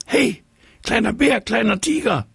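squeaky high fish calling out. A narrator's reading. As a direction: shout up from the water. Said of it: "ruft der Fisch im Wasser".

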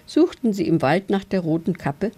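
usual speaking voice

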